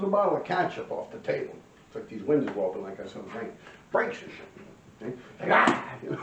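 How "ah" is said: short, loud imitated attack cry; sudden yelled "ah" acting out the lunge. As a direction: let out a sudden mock war cry.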